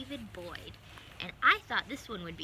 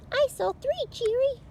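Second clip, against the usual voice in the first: much higher, tiny excited character voice; squeaky little mouse; 12 dB louder than usual. Acted. Cheera calling out to Cheery, high little mouse voice.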